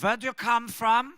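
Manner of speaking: put-on high, loud German-accented question. To the crowd, a German seatmate quizzing him, German accent. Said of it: "it had a German accent".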